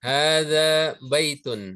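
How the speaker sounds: Hadza baitun drawn out slowly, syllable by syllable; a teacher modelling Arabic for students to repeat; slow model pronunciation. Shown as a demonstration. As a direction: say Hadza baitun slowly so the class can copy. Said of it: "Baik, sama-sama".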